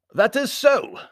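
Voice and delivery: high, loud Baron's voice; formal foreign Baron. A narrator's reading. Baron agreeing with his host, high formal voice. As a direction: agree loudly, pitched well up. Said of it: "agreed the Baron".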